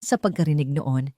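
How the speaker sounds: ordinary delivery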